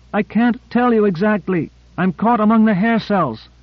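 high-pitched woman's voice in distress; high, urgent character voice; shrill reply. A narrator's reading. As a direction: pitch it way up, calling back. Trapped woman answering shrilly over radio.

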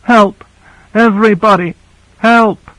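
high-pitched cry, acted by a narrator; shrill scream for help. A narrator's reading. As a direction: cry out high and desperate for rescue. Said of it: "she screamed as shrilly as she could".

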